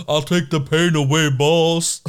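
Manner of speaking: a hint of gentle giant voice; lightly voiced gentle giant; John Coffey. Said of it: "It's like John Coffey's".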